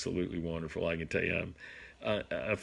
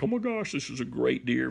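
much higher gasp of wonder; amazed; 4 semitones higher than usual. Retold or imitated. Father seeing son's buck, amazed. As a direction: awestruck at the size of it.

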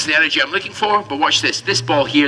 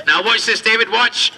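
usual speaking voice

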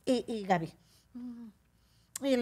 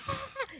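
put-on high whimper of a crying girl; mock child whimper; 5 dB quieter than usual. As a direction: imitate the little girl's cry. La niña llorando. (imitación aguda de niña llorando)

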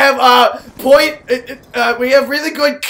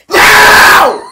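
loud, high cry of No; yelling as his character dies; anguished shout; 6 semitones higher, 8 dB louder than usual. Real, not acted. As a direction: wail it as you die in the game. Gamer gets killed in match.

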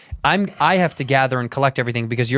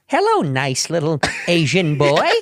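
creepy admirer; 3 semitones higher, slower than usual. Retold or imitated. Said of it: "She's like".

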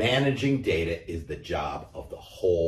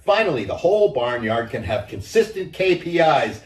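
hen celebrating; 8 semitones higher, 5 dB louder than usual. A narrator's reading.

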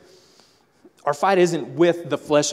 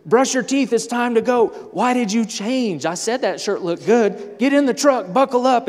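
frazzled parent; 8 semitones higher than usual. Retold or imitated. Stressed parent rushing kids out to church.

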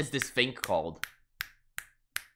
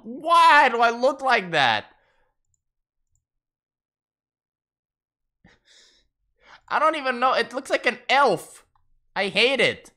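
horrified whine; 9 semitones higher, 5 dB louder than usual. Real, not acted. Streamer horrified by his look.

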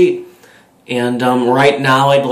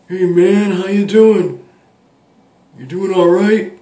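louder and higher stoner greeting; Chong in Cheech voice; 7 semitones higher, 5 dB louder than usual. Retold or imitated. Chong greeting Cheech on the street, louder and higher. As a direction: call out a friendly stoner hello. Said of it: "I saw Chong, and he was like".

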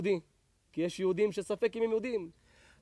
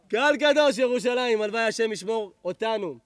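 raised, higher preaching voice; preaching blessing; 4 semitones higher, 5 dB louder than usual. Real, not acted. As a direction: bless the congregation with fervor. Prays blessing over the congregation.

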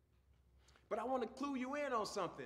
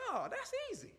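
much higher, mock-childlike realization; relieved schoolchild; 14 semitones higher than usual. Pupil answering the teacher's explanation, high relieved schoolchild. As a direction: play a pupil who suddenly gets it, pitched way up.

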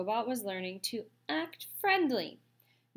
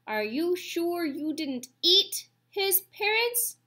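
put-on suspicious old goose; 8 semitones higher, 4 dB louder, slower than usual. A narrator's reading.

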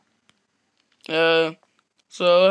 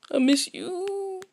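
mock tender grief; 10 semitones higher, 7 dB quieter, slower than usual. Mock mourning for mother.

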